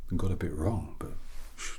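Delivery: usual speaking voice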